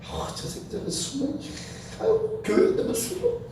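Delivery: hushed, whispered grumbling; muttered cursing. Retold or imitated. Churchgoers grumbling about the man reeking of drink, muttered cursing. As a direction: imitate disgusted whispers behind someone's back. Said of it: "막 욕을 한 거야".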